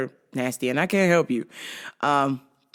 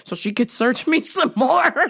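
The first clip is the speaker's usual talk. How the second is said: laughing; 10 semitones higher than usual. Real, not acted. Joking about being searched by the agent, laughing. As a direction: pitch climbing into laughter. Laughs at his own joke.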